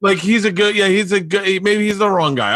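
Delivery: words breaking into a laugh; giggling through the line. Real, not acted. Laughing at own story.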